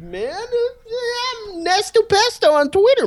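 high, slow stoner-dude sign-off; Jeff Bridges impression. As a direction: stretch it out like a mellow dude. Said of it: "should I say the rest like Jeff Bridges?".

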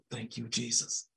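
soft murmured aside to Jesus; murmured prayer. Real, not acted. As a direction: drop to a near-hush prayer under the breath. Murmured prayer aside mid-sermon.

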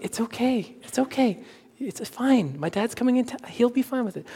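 calm her gently, softly; soft soothing. Retold or imitated. Husband reassuring wife about dad's visit, soft.

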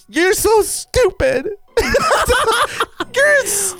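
high, drawn-out fake crying through laughter; laughing parody of a tearful meltdown; mock emotional. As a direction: wail it in a hammed-up sob. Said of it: "I start getting a little emotional".

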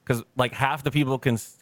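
normal talking voice

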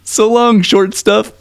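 high, cheeky little doll voice; the Punch doll answering the girl, in a high cheeky voice. Retold or imitated. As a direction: toss it off like a tiny wisecracking doll. Doll's reply retold.

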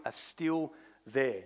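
usual speaking voice

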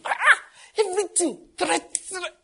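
high, loud exasperation; exasperated exclaim. Real, not acted. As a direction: throw up hands at all the threats.

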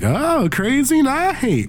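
high, slow, drawn-out exclamation of a bar crowd; mock barfly voice stretching out crazy night; bar patrons. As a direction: pitch it way up like an impressed partygoer. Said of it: "and people are like".

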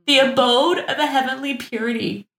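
lofty mock-reverent echo of "abode"; mock grandeur. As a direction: ham up the flowery phrase "heavenly purity". Mock re-reading flowery quote.

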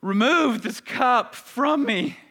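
loud, high, anguished pleading to the Father; groaning prayer. Acted. Jesus groaning his prayer to the Father in anguish. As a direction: cry it out like a man crushed in soul. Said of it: "groaning out these words instead of just speaking them".

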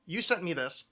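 usual speaking voice

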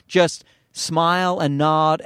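ordinary talk, nothing marked

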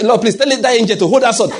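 high, loud laughing plea; hammed-up begging; mock pleading. Preacher acting a frantic believer, loud laughing plea. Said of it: "You say".

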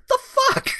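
squeaked indignant disbelief; high mock squeal. The driver insulted by the family, squeaking high. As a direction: squeak out the outrage.

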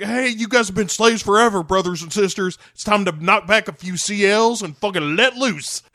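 rally the crowd like a frat bro; party bro voice. Acted. Parodying a party speech.

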